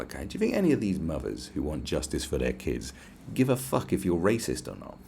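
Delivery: usual speaking voice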